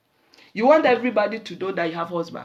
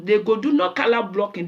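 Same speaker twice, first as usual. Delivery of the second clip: usual speaking voice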